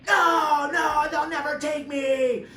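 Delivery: high, loud shout, slowing on "never take me"; defiant shout. Retold or imitated. Inmate yelling refusal at deputies, high desperate shout. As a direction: yell your refusal, slow and desperate.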